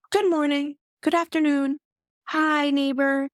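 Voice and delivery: high, bright sing-song greetings; chirpy neighbor. Retold or imitated. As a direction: greet the whole street like a cheery neighbor. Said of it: "is like".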